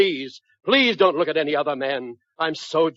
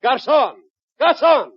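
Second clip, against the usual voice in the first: calls out louder each time, higher and louder; yelling for the waiter; 8 semitones higher, 5 dB louder than usual. Acted. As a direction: summon the garçon across the room, building to a yell. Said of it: "What are you yelling for?".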